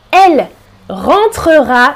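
normal talking voice

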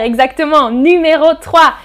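usual speaking voice

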